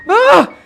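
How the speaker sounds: high, loud cry of alarm; sudden short shout; startled yell. Acted. Startled while searching.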